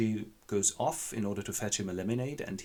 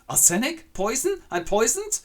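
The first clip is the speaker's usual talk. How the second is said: high, loud rising disbelief; shocked squeals; 7 dB louder than usual. Acted. Luise shrieks in shock at being poisoned. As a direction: shriek each question higher. Girl learns she is poisoned.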